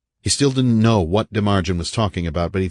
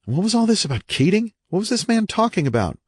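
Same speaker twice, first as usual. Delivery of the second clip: high-pitched, baffled questioning; bewildered. A narrator's reading. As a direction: wonder in alarm at the madness. Visitor's bewildered thoughts.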